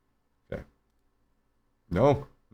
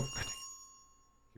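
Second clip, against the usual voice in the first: whispered curse; 23 dB quieter, much slower than usual. Real, not acted. Frustrated at failed phone.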